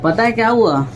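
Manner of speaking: put-on loud show-off boasting to baby over the phone; hammed-up boast, pitched to impress; loud bragging phone call. Bragging to his girlfriend about fainting girls, loud and boastful.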